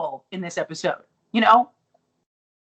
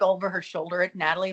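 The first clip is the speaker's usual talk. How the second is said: normal talking voice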